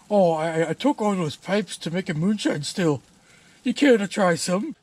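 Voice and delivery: ranting Scottish brogue; Scottish accent. Acted. A mad Scotsman insults the homeowner, in a Scottish accent. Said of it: "doing that Scottish accent".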